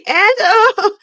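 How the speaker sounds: loud, high wailing laugh in imitation; hammed-up sob tipping into laughter; mock crying laugh. Imitating the wife as her face betrays her, mock laughing and crying. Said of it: "she was crying and laughing at the same time".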